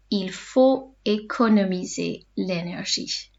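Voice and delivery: slow, careful dictation pace; slow teacher repeat; said slowly. Shown as a demonstration. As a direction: lay the sentence out slowly for learners. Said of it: "say them slowly in between".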